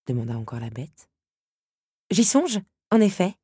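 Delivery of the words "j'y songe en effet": high, lifted voice for Belle; playful concession. A narrator's reading. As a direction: concede it in a higher, louder lilt. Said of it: "concéda Belle".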